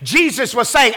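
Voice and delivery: ordinary delivery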